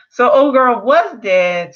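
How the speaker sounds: ordinary delivery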